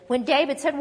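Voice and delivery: ordinary talk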